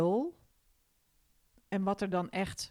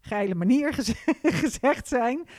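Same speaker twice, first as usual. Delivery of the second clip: laughing; 6 semitones higher, 8 dB louder than usual. Real, not acted. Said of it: "op een, een spannende, geile manier".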